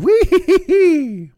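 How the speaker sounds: high, loud whee down a slide; gleeful whee. Pretend. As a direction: squeal like sliding downhill. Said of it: "You're just plummeting downward".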